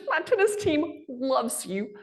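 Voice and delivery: put-on high, slow gushing fan girl; excited fan voice. Stranger at the airport lounge gushing high and slow. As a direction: gush like a starstruck fan. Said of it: "She goes".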